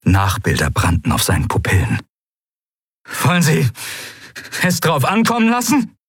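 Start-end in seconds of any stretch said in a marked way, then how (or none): 3.2-5.9 high, heated challenge; defiant challenge. A narrator's reading. Kai dares Sylravan to call the bluff, defiant. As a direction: throw it at them as a dare.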